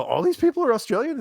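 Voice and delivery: high-pitched incredulous question; retold disbelief. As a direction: replay your disbelief, voice shooting up.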